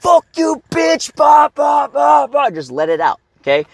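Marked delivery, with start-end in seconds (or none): 0.0-1.2 screaming while punching. Shown as a demonstration. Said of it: "you can fucking scream at the same time". 1.2-2.5 high, loud bah on each hit; rhythmic shouted grunts; punching grunts. Shown as a demonstration. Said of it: "scream at the same time".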